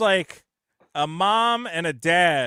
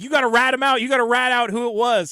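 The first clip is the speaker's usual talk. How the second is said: mock pushy parent; 6 semitones higher, 5 dB louder, faster than usual. Parents urging kid to rat out.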